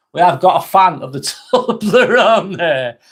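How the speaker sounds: real laugh breaking into the words; amused, laughter bubbling through. Real, not acted. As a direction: crack up partway through the sentence. Amused at finding a Toblerone fan.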